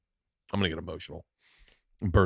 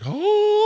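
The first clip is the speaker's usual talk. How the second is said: high, loud held wail; imitated sobbing wail; 7 dB louder than usual. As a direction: mimic grown men bawling in one long cry. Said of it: "watched them sob crying".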